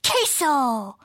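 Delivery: lone pup answer. A narrator's reading.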